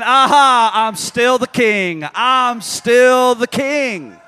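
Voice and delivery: loud, slow proclamation; a preacher voicing a gloating, unbothered king; triumphant God. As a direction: declare it grandly, stretching every word. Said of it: "He's saying".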